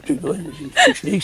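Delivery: high, slow words through laughter; laughing. Real, not acted. Laughing at his own pronunciation.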